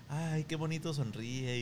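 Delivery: slow, dreamy and drawn out; smitten sigh. Retold or imitated. Teen boys swooning as the girl smiles at them, slow and dreamy. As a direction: melt over her smile.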